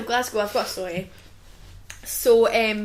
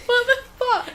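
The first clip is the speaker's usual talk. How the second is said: laughing disbelief; 12 semitones higher than usual. Real, not acted. Reacting to stupid stabbing.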